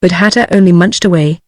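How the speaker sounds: usual speaking voice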